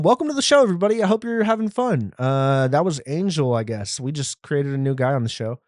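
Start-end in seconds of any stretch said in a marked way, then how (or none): 0.0-2.1 mock host cheer. Mock cheery show opening.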